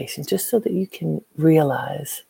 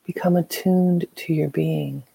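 guided meditation. Acted. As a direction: guide them gently into stillness.

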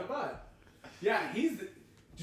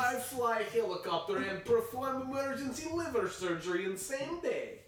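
higher broken-English Putin brag; Russian accent; 7 semitones higher than usual. Retold or imitated. Putin bit continues.

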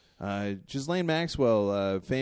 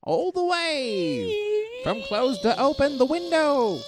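carnival barker; 11 semitones higher than usual. Acted. Barker hyping the rickety screen door.

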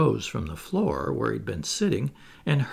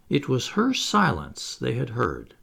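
plain reading voice

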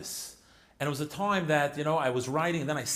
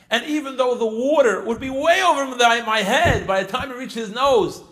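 higher and much louder, building with conviction; impassioned daughter; 6 semitones higher, 7 dB louder than usual. Retold or imitated. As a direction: let the daughter's faith swell as she speaks. Daughter voiced, rising passion.

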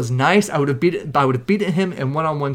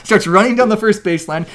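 ordinary talk

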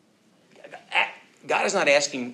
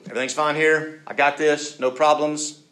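loud, higher-pitched false assurance; confident front; 5 semitones higher, 9 dB louder than usual. Acted. Sinner putting best foot forward, loudly assured. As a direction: insist all is under control. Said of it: "trying to put our best foot forward".

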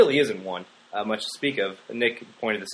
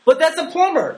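excited; 8 dB louder than usual. Real, not acted. Joking emphatic punchline, high.

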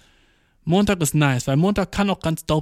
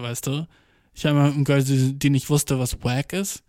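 ordinary delivery

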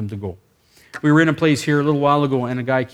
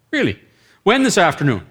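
higher, suddenly keen questioning; perking up with interest; 5 semitones higher, 5 dB louder than usual. Retold or imitated. Team leader quizzing the sick man, perking up. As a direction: jump on it with eager surprise. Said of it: "I'm starting to perk up already".

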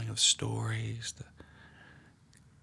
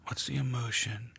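guided meditation. Acted. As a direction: draw them gently into the scene. Leads a guided prayer meditation.

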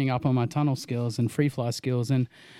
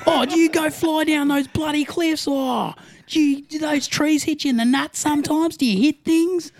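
put-on much higher, loud, laughing ocker Australian accent; mock Aussie bloke; 13 semitones higher, 5 dB louder than usual. Friend mocking a clueless Aussie's wingsuit questions in a high, loud Australian accent.

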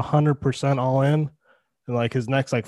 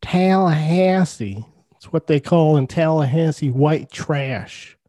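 drawn-out, higher mock Southern accent; attempted Southern accent; 3 semitones higher than usual. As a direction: badly fake a Southern US accent, stretching the vowels. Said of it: "I don't think that was a Southern accent".

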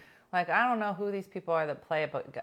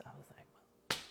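whisper; 4 semitones lower, 17 dB quieter than usual. Retold or imitated. Dramatic build-up to bagpipes at funeral.

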